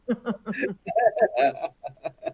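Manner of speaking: easy, open laugh at himself; real laugh. Real, not acted. Guest laughs about crashing a full call, amused. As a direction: laugh it off, amused at being the goober.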